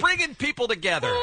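usual speaking voice

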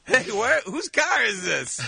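groggy bobcat. Pretend. Voicing the waking bobcat.

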